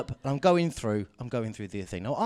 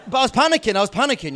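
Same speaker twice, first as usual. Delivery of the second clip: loud, higher, hammed-up panicking; mock panic; 10 semitones higher, 10 dB louder, faster than usual. Comic telling the crowd he panicked, in a loud mock panic. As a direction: blurt it out like a panicked tourist.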